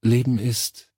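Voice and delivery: tentative restart, breaking off; unsure start. A narrator's reading. Woman gropes for a definition of life, hesitant. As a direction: try the phrase hesitantly and stop. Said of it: "begann sie unsicher".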